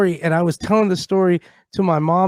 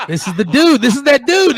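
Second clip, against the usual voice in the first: laughing through the words, louder and higher; laughing praise; 6 semitones higher, 5 dB louder than usual. Retold or imitated. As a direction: cheer him on through a laugh. Laughing praise of nugget kid.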